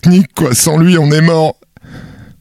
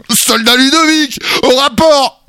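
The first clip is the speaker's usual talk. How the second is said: drill sergeant; 9 semitones higher than usual. Acted. Mock military roll call.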